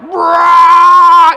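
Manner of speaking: loud, high held "raaah" like a siren; blaring siren imitation; imitated siren wail. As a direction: wail out a fire siren at full volume. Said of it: "It was so loud".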